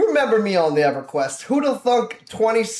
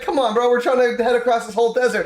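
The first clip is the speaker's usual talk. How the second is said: higher, urging voice; retold pleading with a buddy to move; mock exasperated plea; 6 semitones higher than usual. Players urging gnome Toby across the desert, mock exasperated. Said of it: "And we're like".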